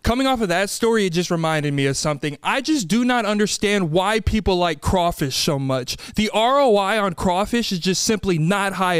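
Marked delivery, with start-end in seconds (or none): none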